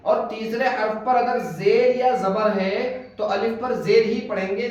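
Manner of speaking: ordinary delivery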